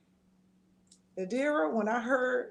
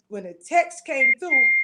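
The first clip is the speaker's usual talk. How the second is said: emphatic; 3 semitones higher, 9 dB louder than usual. Real, not acted. Recalling the fatal text.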